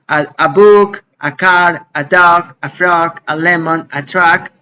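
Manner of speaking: "a book" to "a truck", each A said "ah"; Spanish A article. Shown as a demonstration. Teacher drilling "a book" to "a truck" with A as "ah". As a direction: list them with every "a" as a Spanish open "ah". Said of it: "la pronuncio como la A del español".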